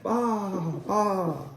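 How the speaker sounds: small lamb voice; lamb bleating. Acted. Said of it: "the lambs said".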